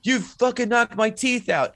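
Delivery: high-pitched, drawn-out mock complaint; hammed-up wail of a beaten thug; mock outraged movie villain. Retelling a film fight as the toothless thug, mock outrage.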